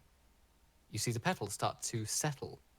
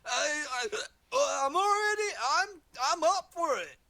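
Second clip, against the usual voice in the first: high, loud, stammering whoop; overexcited and tripping over his words; hyped party guy; 5 dB louder than usual. Acted. A party guy yelling his excitement from a portal, whooping. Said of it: "one guy go".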